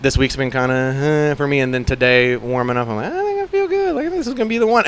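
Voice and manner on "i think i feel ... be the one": excited. Retold or imitated. Hyped-up quote about feeling good.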